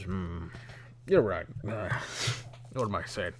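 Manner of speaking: mildly tipsy boatman giving in; halting, lightly boozy haggler; drunk middle-aged man. Acted. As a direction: concede with long thinking pauses, a little drunk. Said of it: "drunk middle-aged man".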